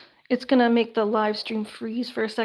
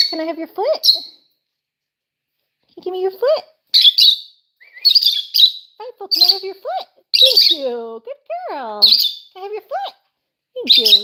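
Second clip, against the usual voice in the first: higher sing-song coaxing for the bird; coaxing pet talk; 7 semitones higher, 7 dB louder than usual. Real, not acted. Owner asks her parrot Frightful for its foot, high coaxing pet voice. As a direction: sweetly coax the parrot to give its foot.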